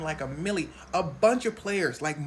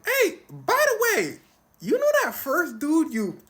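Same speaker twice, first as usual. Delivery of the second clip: eager confession; 10 semitones higher, 8 dB louder than usual. Retold or imitated. Fan telling Ron secret.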